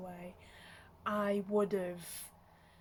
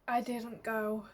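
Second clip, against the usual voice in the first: normal talking voice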